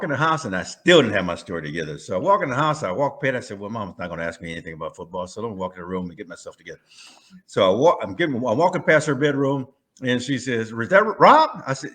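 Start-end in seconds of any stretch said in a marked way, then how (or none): 3.6-6.8 inner thought. Retold or imitated. Thinking to himself. 10.7-11.6 mother calling. Retold or imitated. Mother calls from bedroom.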